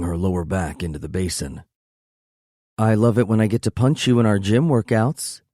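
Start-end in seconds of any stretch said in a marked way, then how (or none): 2.8-5.4 higher, louder playful woman; teasing add-on. Acted. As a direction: toss it up at him playfully. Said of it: "she adds".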